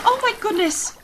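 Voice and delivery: high, loud shocked cry; horrified gasp. A narrator's reading. Will watching his husband fall overboard, crying out in horror. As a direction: blurt it out in horror. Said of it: "Will gasped in horror".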